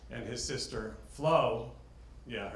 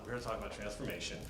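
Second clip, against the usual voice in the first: quick, softer repeat of the opening; toned down; 8 dB quieter, much faster than usual. Real, not acted. Speaker correcting himself after being too loud, quieter. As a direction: pulls it back and says it quietly. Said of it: "Sorry, I'm talking so loud".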